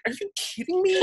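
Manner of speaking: shocked delight, pitch flying up; stunned disbelief. Retold or imitated. Hearing his own name read as non-sponsor, high disbelieving squeal. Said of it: "And I was like".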